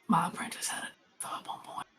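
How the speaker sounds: breathy secret whisper. Acted. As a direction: lean in and whisper it. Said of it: "he's whispering".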